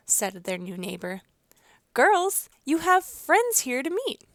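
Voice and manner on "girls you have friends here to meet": high, loud call into the house. A narrator's reading. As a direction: call the girls from the doorway. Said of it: "she called".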